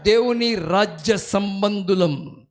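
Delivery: slow, weighty call-out for repeating; slow declaration. Shown as a demonstration. Preacher leading the congregation, slow and deliberate. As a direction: spell it out slowly for them to repeat. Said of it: "గట్టిగా చెప్పాలి".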